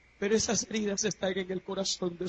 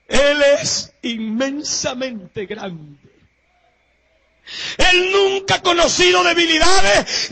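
shouted, higher, slowed preaching; preacher shouting about Jesus to the congregation; preaching at full force; 6 semitones higher, 11 dB louder, slower than usual. Acted. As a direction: thunder it from the pulpit. Preaching climax.

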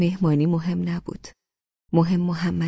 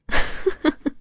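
high, breathy burst of laughter; hearty laugh; 9 semitones higher than usual. A narrator's reading. Husband amused by his sulking wife, hearty laugh. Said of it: "از ته دل خندید".

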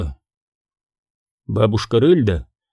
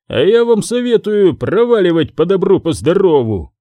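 higher, mildly barked threat; gruff bouncer's bark, pitched up; barked warning; 6 semitones higher, 4 dB louder than usual. A narrator's reading. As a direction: bark it at them to clear off. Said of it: "гаркнул первый".

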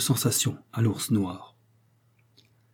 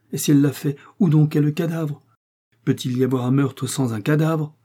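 raised, insistent questioning; exclaimed question; 5 semitones higher, 5 dB louder than usual. A narrator's reading. Innkeeper returning to the point he never understood, exclaiming. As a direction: cry out the puzzle, loud and emphatic. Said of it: "s'écria Packer".

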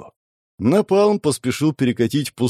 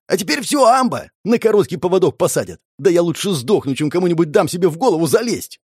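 much higher, heated rant; desperate outburst; 6 semitones higher than usual. A narrator's reading. Cornered man would rather die than submit, desperate. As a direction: rail against being leashed, pitch held high.

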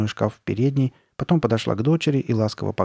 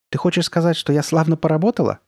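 raised pitch, assertive question; brooking no objection; 6 semitones higher, 4 dB louder than usual. A narrator's reading. Mother presses daughter for praise of her work, brooking no objection. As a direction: ask it so nobody can object. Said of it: "тоном, не требующим возражения".

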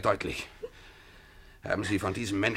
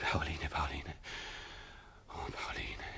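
soft whispered name; tender whisper; 6 semitones lower, 15 dB quieter, slower than usual. Acted. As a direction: breathe her name tenderly. Alexei whispers her name tenderly.

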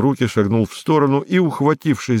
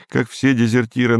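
usual speaking voice

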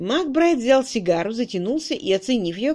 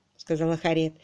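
plain reading voice

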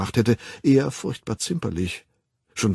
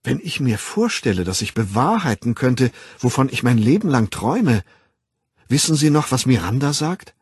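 raised voice, dreamy excitement; elated anticipation; 4 semitones higher, 4 dB louder than usual. Acted. Young man invited to London, elated and eager. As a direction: marvel at a lifelong dream coming true.

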